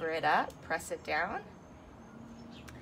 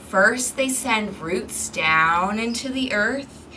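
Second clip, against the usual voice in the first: ordinary delivery, nothing marked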